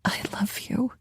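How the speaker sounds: fighting tears on the words; tearful whispered confession; voice breaking. Acted. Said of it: "My voice broke".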